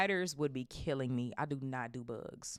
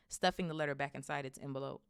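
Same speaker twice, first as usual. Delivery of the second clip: usual speaking voice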